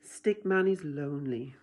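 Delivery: usual speaking voice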